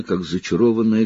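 ordinary delivery

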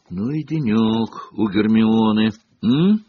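usual speaking voice

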